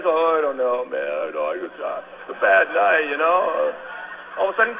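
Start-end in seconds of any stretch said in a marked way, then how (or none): 0.0-3.7 low, limp, deflated voice; deflated mumble. Retold or imitated. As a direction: sag into excuses. Recruit deflated the next day.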